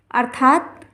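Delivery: ordinary delivery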